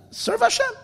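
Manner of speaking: very high, earnest simple voice; simple man's earnest answer. Acted. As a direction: say it with heartfelt simplicity. Attendant's sincere answer.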